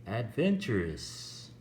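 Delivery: "adventurous" slow, with VEN stressed; second-syllable stress. Shown as a demonstration. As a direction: stress "adventurous" as ad-VEN-tu-rous, drawn out slowly. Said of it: "we stress the second".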